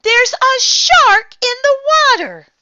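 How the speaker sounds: very high, loud panicked warning; alarmed yell. A narrator's reading. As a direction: keep screaming the warning. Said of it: "somebody yelled".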